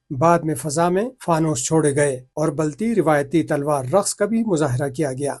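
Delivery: measured, formal bulletin delivery; news read. Acted. Reporter reading the festival report to viewers, formal news read.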